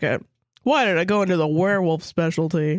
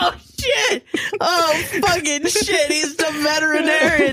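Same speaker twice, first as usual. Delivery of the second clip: laughing; 8 semitones higher than usual. Real, not acted. Realizing the doctor is a vet.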